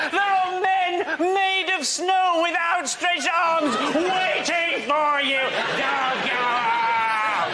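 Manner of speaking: high, slow hysterical warning shout; hammed-up panic broadcast, slowed right down; mock BBC alarm. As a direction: wail it like a doom-laden newsreader. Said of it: "the B B C were going".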